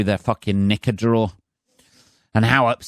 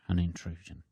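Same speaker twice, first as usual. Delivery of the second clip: An intrusion repeated much softer, almost breathed; hushed echo; 5 semitones lower, 13 dB quieter than usual. Shown as a demonstration. Mocking the guy's word, a hushed echo. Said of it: "that is the word he used".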